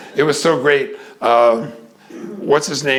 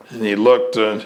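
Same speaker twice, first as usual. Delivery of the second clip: normal talking voice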